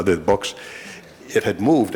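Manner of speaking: normal talking voice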